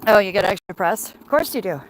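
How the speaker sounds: ordinary talk, nothing marked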